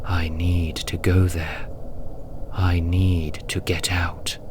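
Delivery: contained, murmured yearning; hushed inner plea. Acted. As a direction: think it aloud, under the breath. Trapped flesh's inner plea.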